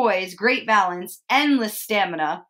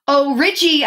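higher, louder mock-sweet coo; sugary, pitched-up taunt; 5 semitones higher, 5 dB louder than usual. A narrator's reading. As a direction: coo it at someone you are challenging. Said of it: "I cooed".

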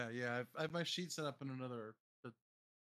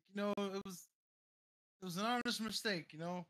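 raised, pleading excuse voice; character voice; 5 semitones higher than usual. Acted. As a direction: plead it off as an honest mistake.

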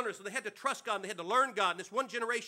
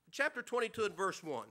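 usual speaking voice

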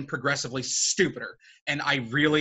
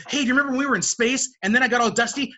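put-on fast, higher, chirpy kid gabble; mocking Peter Parker; 7 semitones higher, 4 dB louder, faster than usual. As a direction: rattle it off like an overeager kid. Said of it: "when he goes in the spiel".

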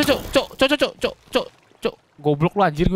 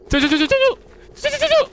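panicked yelping. Real, not acted. Panicked in horror game.

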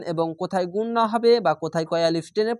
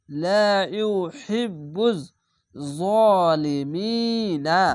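জলিমিনা chanted very slowly, last vowel kept; continued recitation; much slower than usual. Shown as a demonstration. Teacher recites the verse flowing on, slow chant. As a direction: recite without stopping, vowels drawn. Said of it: "তিন আলিফ টেনে পড়তে হবে".

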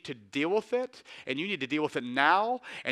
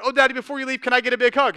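put-on higher, louder little-girl voice; daughters' sweet ask; 5 semitones higher, 7 dB louder, faster than usual. Dad retelling his daughters begging for a hug before he leaves. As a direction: play the child pleading for a hug.

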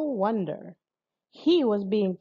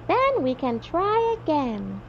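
put-on high, slow old lady voice; little old lady; 6 semitones higher than usual. A narrator's reading. Old lady encourages the travelers, high slow voice. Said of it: "said the little old lady".

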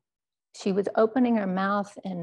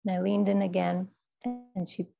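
normal talking voice

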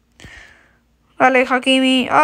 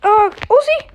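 excited shout; 7 semitones higher, 5 dB louder than usual. Real, not acted. Scoring chance in game.

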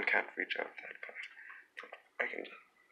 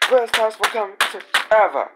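squeaky sarcastic voice; 11 semitones higher, 11 dB louder, faster than usual. Pretend.

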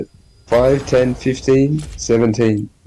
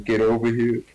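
scorpion voice. Retold or imitated. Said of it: "Scorpion's voice line".

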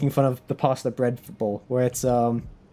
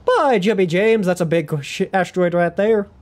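punchy Southern American exclamation; Southern accent; 3 semitones higher than usual. Acted. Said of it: "went immediately into Southern".

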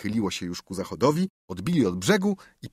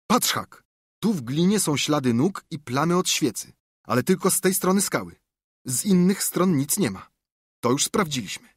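put-on excited boy, higher pitched; boy with a sudden idea, pitched up; eager boy, higher; 6 semitones higher than usual. Tomek's voice in story.

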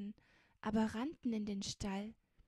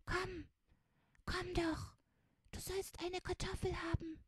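high, small hushed voice; whispering child; 6 semitones higher than usual. A narrator's reading. Child in hiding shares food and calls Mimutz, high and hushed. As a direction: half-whisper sweetly, coaxing higher at the end.

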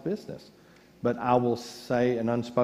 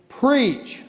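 a high, loud punch on the word; loud exclamation; 11 semitones higher, 7 dB louder than usual. Acted. Quoting Augustine to the congregation, a loud high punch. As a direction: belt it out.

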